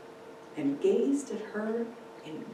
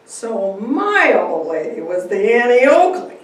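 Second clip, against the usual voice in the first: amused; 4 semitones higher, 12 dB louder than usual. Acted. Father laughing about his wife.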